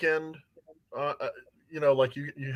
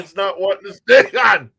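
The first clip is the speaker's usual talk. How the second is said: strained outburst; 4 semitones higher, 10 dB louder than usual. Real, not acted. Gagging on the durian.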